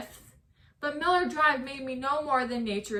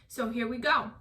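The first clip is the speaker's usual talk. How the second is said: ordinary talk, nothing marked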